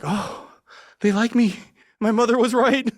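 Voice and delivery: high, loud gleeful delight; giddy realization. Retold or imitated. Telling how Vice paid for his old antics, giddy high squeal. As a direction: squeal it like a kid who finally got approval. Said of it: "And I was like".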